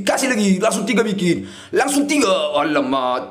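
ordinary talk, nothing marked

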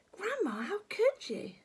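put-on high-pitched child dismay; plaintive boyish reproach; appalled boy. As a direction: gasp it out as a shocked little boy. George appalled.

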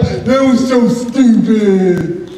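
put-on snarky kid. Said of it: "and go".

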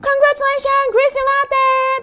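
high, sustained winner announcement; excited congratulations. Real, not acted. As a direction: hail the winner in a high celebratory voice.

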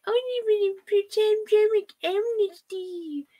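high, sugary mock voice of the writer; mocking her. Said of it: "of this bitch to say".

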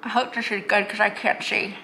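lip pulled down by fingers; talking while fitting mouth tray; speaking with fingers in mouth. Real, not acted. Seen 1: fingers hold lower lip and teeth while fitting a tray.

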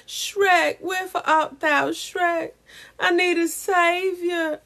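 high, drawn-out mock-dramatic plea; Fiona. Calling to Shrek for a savior, mock-dramatic. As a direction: play a lovelorn damsel calling out. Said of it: "that's how I became Fiona".

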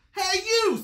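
high, loud challenge; pugnacious little ladybug; grouchy ladybug. A narrator's reading. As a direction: call out high like a grouchy bug picking a fight. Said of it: "said the grouchy ladybug".

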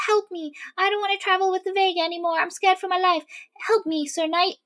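a high, quick, fake-scared plea; faked fear. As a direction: play the helpless girl, pitched high and hurried. Skadi fakes Inara's plea for help.

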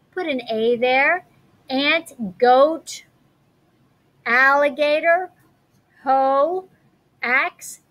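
normal talking voice

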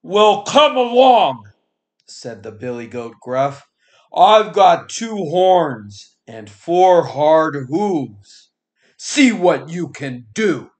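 big goat daring the troll to fight; big goat challenge. Acted. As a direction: dare the troll, booming and unhurried.

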